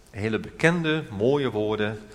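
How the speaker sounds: ordinary delivery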